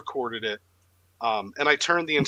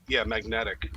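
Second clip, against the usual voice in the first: ordinary delivery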